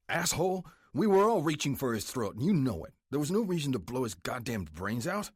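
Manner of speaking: furious shout. Acted. Vice president yells at Roman after the killing, furious. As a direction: lay into him, furious.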